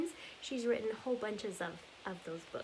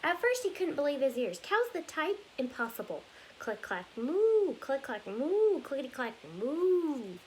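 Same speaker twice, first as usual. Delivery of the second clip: lively storybook read-aloud, rhythmic and bouncy; reading to children; 6 dB louder than usual. Acted. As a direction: read it like story time for little kids. Reads picture book aloud.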